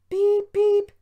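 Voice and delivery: very high, loud imitated engine whistle; piercing spoken beeps; whistle call. A narrator's reading. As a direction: toot like a little train whistle. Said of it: "he whistled to Duncan".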